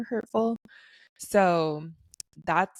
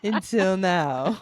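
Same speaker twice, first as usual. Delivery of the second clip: slow, dramatic mystery-novel reveal; playfully sultry and unhurried; slower than usual. Pretend. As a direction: draw it out like an audiobook teaser. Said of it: "I love that voice".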